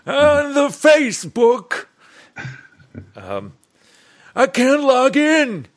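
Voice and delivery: put-on high, slow old man voice. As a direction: play a confused grandpa at the computer. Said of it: "say it in an old man voice".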